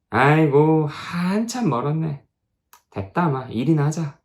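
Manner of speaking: lightly voiced weary elder, tutting; dismissive old missionary. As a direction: wave off the young man and get back to work.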